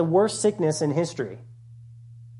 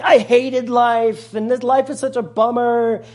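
mock despair; 7 semitones higher, 7 dB louder than usual. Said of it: "Solomon is like".

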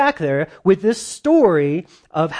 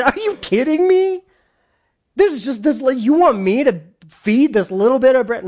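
much higher, louder scoffing voice; mocking disbelief; 9 semitones higher, 4 dB louder than usual. Servant scoffs at prophet's plan about bread, mocking and much higher. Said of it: "He, he mocked him".

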